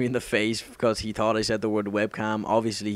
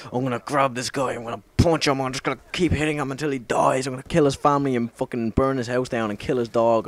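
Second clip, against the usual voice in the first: higher-pitched, escalating imitation of rage; mock vengeful rant; 4 semitones higher than usual. Acting out first thoughts after being punched, mock vengeful rant. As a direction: pile up the threats in a hammed-up fury.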